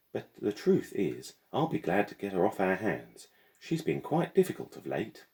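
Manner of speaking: high, lofty headmistress voice; pompous headmistress. A narrator's reading. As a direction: lament it like a grand old schoolmarm.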